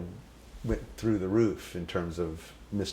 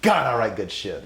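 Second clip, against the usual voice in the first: mock self-praise ending in laughter; laughing boast; 5 semitones higher, 5 dB louder than usual. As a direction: brag and crack up. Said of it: "And he goes".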